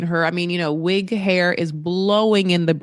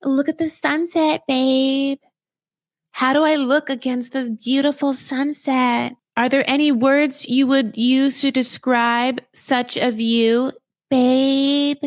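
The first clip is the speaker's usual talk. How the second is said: high, drawn-out mock girlfriend voice; compliment-fishing girlfriend; 6 semitones higher, slower than usual. Girlfriend posing against the sunset for babe, hammed-up coy. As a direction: fish for compliments in a higher, put-on voice.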